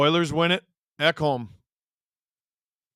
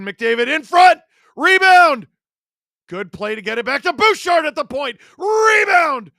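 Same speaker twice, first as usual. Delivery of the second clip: excited shout; 7 semitones higher, 9 dB louder than usual. Real, not acted. Live play-by-play, scramble in front.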